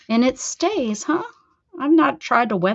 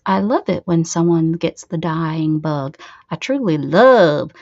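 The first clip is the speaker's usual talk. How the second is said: normal talking voice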